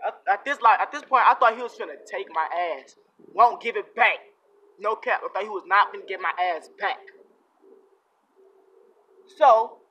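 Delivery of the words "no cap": ordinary talk, nothing marked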